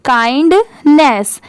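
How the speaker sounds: slow kindness, split "kind... ness"; word in two parts. Shown as a demonstration. Teacher breaks the word up for pupils, slow and split. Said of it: "इतना हम लोग क्या पढ़ते हैं?".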